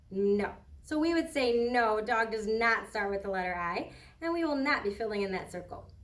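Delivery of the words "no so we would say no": usual speaking voice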